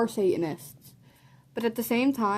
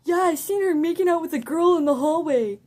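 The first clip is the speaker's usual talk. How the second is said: raised, higher mock yell; 6 semitones higher, 5 dB louder than usual. Kid across the classroom accuses her, yelling out. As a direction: call it out like a heckler. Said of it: "wanna yell out".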